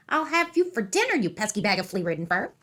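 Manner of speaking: a cross leprechaun cursing down a burrow; threatening leprechaun. A narrator's reading. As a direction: threaten the rabbit in a tiny fierce voice.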